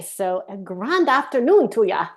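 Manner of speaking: slow, put-on Irish accent; a friendly Irish greeting in an Irish accent. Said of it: "My best Irish accent I can muster".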